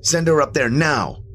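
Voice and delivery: high, pleading demand; insist, voice up and urgent. A narrator's reading. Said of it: "I demanded".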